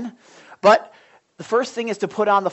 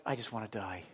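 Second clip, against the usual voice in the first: much lower and softer, a dark murmur; low hush; 9 semitones lower, 16 dB quieter than usual. Retold or imitated. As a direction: let it sink low and soft. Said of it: "I'll just be like".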